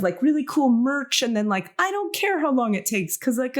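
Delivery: usual speaking voice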